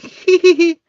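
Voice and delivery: very high, loud "hee hee" giggle; imitated squeaky-high titter; mock giggle.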